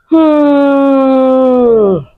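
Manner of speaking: long, high pained hum; high groan through closed lips. Retold or imitated. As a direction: draw out a high hmm of remembered pain. Remembering indescribable labor pain.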